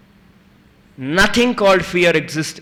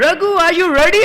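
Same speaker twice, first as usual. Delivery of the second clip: high, loud instructor's call; loud, high "are you ready?"; instructor asks; 11 semitones higher, 6 dB louder, much faster than usual. Retold or imitated. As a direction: call out over the wind to the jumper. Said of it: "And then he said".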